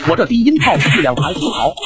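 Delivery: ordinary delivery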